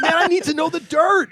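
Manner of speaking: mocking quote. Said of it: "He's like".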